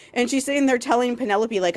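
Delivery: ordinary delivery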